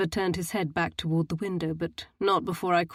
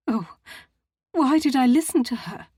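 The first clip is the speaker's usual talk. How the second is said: anguished regret; 7 semitones higher, 6 dB louder than usual. A narrator's reading. Matilda's tearful regret.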